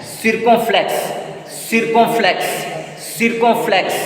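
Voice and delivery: "Circumflex" said "seer-kon-flex"; "Circumflex" with ee and nasal o; French pronunciation drill. Shown as a demonstration. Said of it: "In French".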